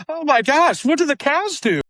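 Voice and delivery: put-on high, startled old lady voice; shocked grandmother. Grandmother watching cows get shot, high and shocked. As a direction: gasp it out like a horrified grandma. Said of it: "and says".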